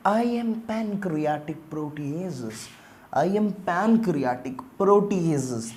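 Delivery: very slow, stretched self-introduction; enzyme introducing itself. Acted. Protease telling students its name, slow character introduction. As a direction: draw out each word as the character.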